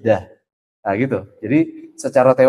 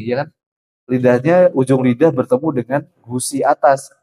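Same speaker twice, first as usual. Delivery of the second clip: ordinary talk, nothing marked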